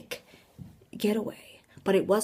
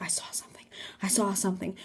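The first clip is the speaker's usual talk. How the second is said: soft, urgent repeated whisper; scared whisper; 8 dB quieter, faster than usual. Retold or imitated. Harold running back to friends, scared whisper. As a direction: whisper it twice in alarm.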